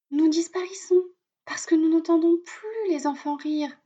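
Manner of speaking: high, earnest bird voice; little robin voice. A narrator's reading. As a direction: plead like a tiny worried robin. Robin explains at length.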